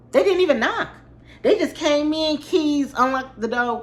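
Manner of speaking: high, indignant outburst; outraged disbelief. Real, not acted. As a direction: voice jumps up in outrage at them. Outraged retelling of entry.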